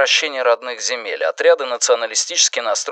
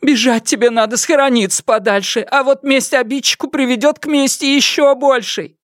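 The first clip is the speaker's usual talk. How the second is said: high, anxious motherly counsel; agitated warning; 13 semitones higher than usual. A narrator's reading. Mother warning son-in-law against revenge, high agitated voice. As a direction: press the warning on him, high and worried. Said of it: "волновалась матушка".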